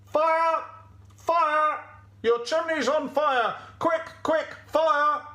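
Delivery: high, loud shout of warning; alarm cry from outside. Acted. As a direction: raise the alarm at high pitch. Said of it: "a voice that said".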